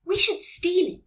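exclaiming. A narrator's reading. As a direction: exclaim a bold plan. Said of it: "exclaimed Jessie".

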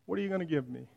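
soft, weary plea; quiet echo. Acted. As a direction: say it again under your breath, worn out. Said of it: "quieter echo of the cry".

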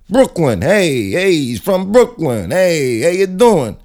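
put-on Brooklyn accent. The dog from Brooklyn says hello, loud Brooklyn accent. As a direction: call out in a broad mock Brooklyn accent. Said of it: "that dog is actually from Brooklyn".

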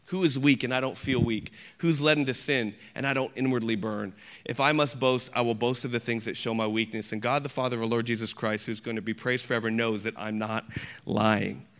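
low, soft, subdued recitation; solemn scripture reading. Acted. Preacher reading Paul's confession of weakness, low solemn hush. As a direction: bring it right down, quiet and earnest.

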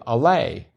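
"Alay" said uh-LAY; Alay ending in LAY. Shown as a demonstration. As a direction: say "Alay" to rhyme with day. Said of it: "the mystery of".

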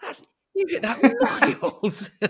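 high, loud squeal breaking into laughter; mock amazed reaction. Random fan reacting to a player's shot, mock amazed. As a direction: gush like an overawed fan, laughing. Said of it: "that kind of reaction".

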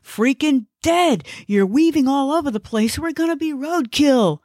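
high-pitched frantic alarm; frantic high-voiced passenger fearing a crash; panicked warning. Panicked passenger warns driver.

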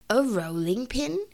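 measured, questioning repeat with a rise; puzzled cousin. Acted. Ribby wondering about the stolen rolling pin, puzzled. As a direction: echo it as a calm, puzzled question. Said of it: "said Ribby".

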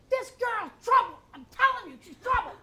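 high, hushed imitation of a disapproving mother; mother's warning. Mother warning her son about the girl, high and hushed. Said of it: "she would go".

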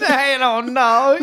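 put-on high, slow drawn-out child voice; a mock-toddler shrug in words; whiny kid voice. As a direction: echo the toddler's clueless answer. Repeating child answer.

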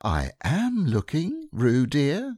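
stern patience; rather severe. Acted. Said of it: "said Kanga rather severely".

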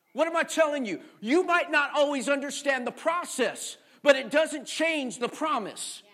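loud, rising sermon cadence; loud, insistent sermon climax; preaching at full force. Acted. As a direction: drive each promise home to the crowd. Preaching build.

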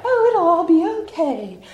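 high, sing-out mock optimism; mock breezy reassurance. Speaker parodies how she may sound, mock breezy reassurance. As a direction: pitch it up, pretending everything is fine. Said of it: "I may sound a tad like".

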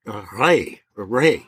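"Reh" said the German way, as one long "ray"; German word Reh. Shown as a demonstration. Telling the farmer what they hit, German word Reh. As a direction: give "Reh" its German sound, twice. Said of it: "in the German word".

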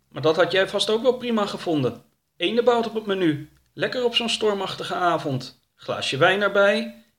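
high, reproachful creature voice; huffy and high; indignant Wubbel. Acted. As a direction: scold your companion, voice pitched well up.

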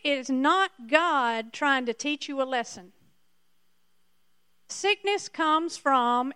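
ordinary talk

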